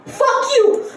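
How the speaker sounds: high, punchy mock yell; hammed-up defiance; defiant outburst. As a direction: fire it back like a furious little kid. Retold child's outburst.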